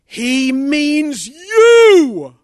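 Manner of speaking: slow, drawn-out, high and loud inner voice; the voice in his heart. A narrator's reading. Voice in the heart tells the sinner, drawn-out call. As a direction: stretch it out like a voice from heaven. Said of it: "And the voice said".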